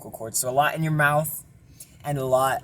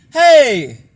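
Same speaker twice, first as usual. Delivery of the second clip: belted shout, high and loud; belting; 10 semitones higher, 15 dB louder than usual. Shown as a demonstration. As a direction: belt it out full voice. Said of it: "try belting".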